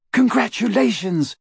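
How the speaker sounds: very high delighted squeal. A narrator's reading. Two friends congratulating the new father, squealing. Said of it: "Hermione and Fleur both squealed".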